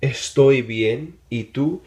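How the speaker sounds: Estoy bien stretched out slowly; slow model phrase. Shown as a demonstration. Teacher modeling an answer slowly for the class. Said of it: "You could say".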